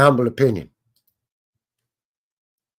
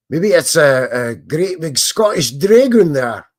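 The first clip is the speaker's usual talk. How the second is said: a weak mock Scottish accent, louder; bad Scottish accent; 4 semitones higher, 8 dB louder than usual. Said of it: "That's a terrible accent".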